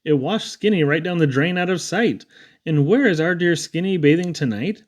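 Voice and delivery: ordinary delivery, nothing marked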